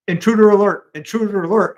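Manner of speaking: imitating the talking car alarm. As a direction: announce it like an automated car alarm. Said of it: "the car alarm went off".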